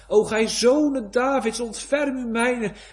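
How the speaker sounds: loud, high pleading cry to the Son of David. Acted. Sinner cries out to Jesus for mercy, loud and high. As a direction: cry out for mercy at the top of your voice.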